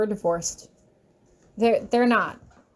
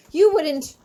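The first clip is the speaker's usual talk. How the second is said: angry outburst; 6 semitones higher, 5 dB louder than usual. Acted. Angry repeat.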